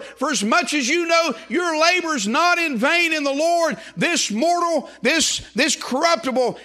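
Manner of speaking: high, driving scripture proclamation; sustained high, ringing preacher's delivery; full-force preaching. Real, not acted. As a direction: build to a fervent pulpit peak.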